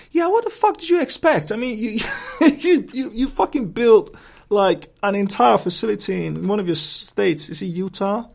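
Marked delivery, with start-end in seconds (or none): 0.1-1.5 much higher, louder mock yell of disbelief; incredulous outburst. Vlogger mocking the US government's complaint, in an incredulous outburst. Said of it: "I'm like". 1.5-6.7 high, loud laughing splutter; laughing disbelief. Real, not acted. Vlogger laughing at the government's surveillance hypocrisy, in laughing disbelief.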